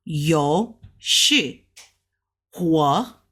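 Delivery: yo low, shi up, hua low; Mandarin tones. Shown as a demonstration. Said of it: "So this one says".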